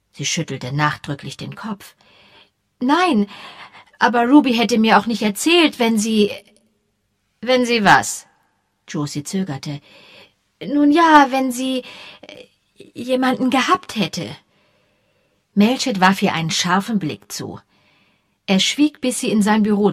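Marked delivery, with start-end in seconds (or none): 2.8-14.3 lightly voiced young woman, embarrassed; flustered young woman. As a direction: stumble over the words, caught out. Josie hesitant, read aloud.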